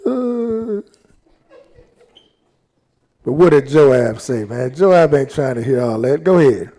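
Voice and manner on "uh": "Oh, Absalom" rising higher into a keening, half-hammed wail; mock grieving cry. Bible teacher imitates David grieving his son, a mock high wail. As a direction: imitate a grieving king crying out, not quite for real. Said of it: "He cried".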